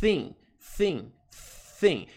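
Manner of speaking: "thin" with a lisped s for th; isolated "thin", clear th at the start; tongue-tied th. Shown as a demonstration. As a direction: say "thin" like sim with the tongue caught between the teeth. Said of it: "sim com a língua presa".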